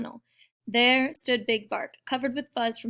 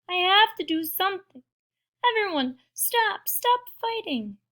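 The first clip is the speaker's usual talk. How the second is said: high-pitched, urgent plea; pleading small character; 9 semitones higher, 4 dB louder than usual. Acted. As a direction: beg the crowd in a high voice. Pip pleads to stop fight.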